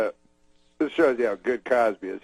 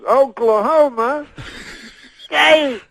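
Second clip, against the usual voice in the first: high, loud, drawn out slow call; Cosby stretching the team name for the crowd, slow and loud; said slower; 13 semitones higher, 4 dB louder, much slower than usual. Retold or imitated. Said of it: "then he says it slower".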